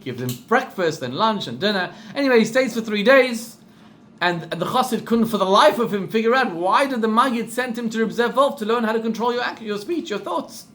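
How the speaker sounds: ordinary talk, nothing marked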